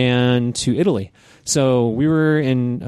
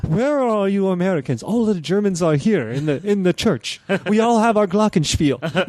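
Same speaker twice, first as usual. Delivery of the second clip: higher, hammed-up German accent; bad German accent; 7 semitones higher than usual. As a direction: ham up a German best man calling the Americans to church. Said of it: "I don't have a very good German accent".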